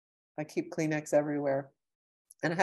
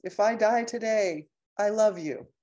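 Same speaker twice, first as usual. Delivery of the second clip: high, loud, gushing declaration; a high, bright burst of love; heartfelt call to a friend; 6 semitones higher, 7 dB louder than usual. Retold or imitated. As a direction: pour it out to her like it's your last chance. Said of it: "and just say".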